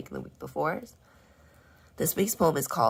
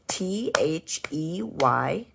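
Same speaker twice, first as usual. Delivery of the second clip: slow spelling; 3 semitones higher, much slower than usual. Shown as a demonstration. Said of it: "spelling aloud letter by letter".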